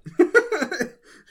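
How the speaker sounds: a burst of high, loud laughter. Real, not acted. Cohost cracks up at the Vor running joke, loud high laugh.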